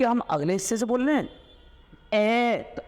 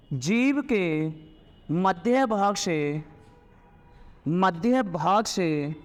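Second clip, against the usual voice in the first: words drawn out very slowly; slow dictation; 3 semitones lower, much slower than usual. Shown as a demonstration. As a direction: dictate it for note taking. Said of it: "slow dictation of definition".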